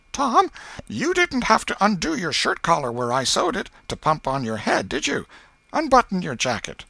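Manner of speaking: aunt slyly questioning nephew Tom about school; Aunt Polly. A narrator's reading. Aunt Polly's new trap.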